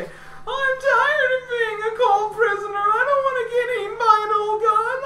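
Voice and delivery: put-on very high, crying, tearful girl's plea; Charmin's voice. Captive Charmin calling to her rescuers, high and crying. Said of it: "you hear Charmin's voice say".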